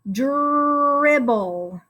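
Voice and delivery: "Dribble" with a long drawn-out dr at the start; sounding out. Shown as a demonstration. Teacher helps pupils try the word, sounding out. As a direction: stretch the opening dr of "Dribble". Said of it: "Try sounding out the word dribble".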